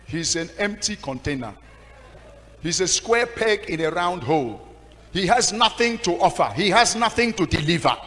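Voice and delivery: loud, high, scornful denunciation; mock outraged church member; angry complaint. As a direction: tear him down in front of the church. Church woman denouncing the pastor.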